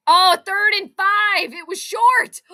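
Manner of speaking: loud, high cry at the play; excited shout. Real, not acted. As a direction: shout it high and drawn-out, caught up in the game. Cries out at the play.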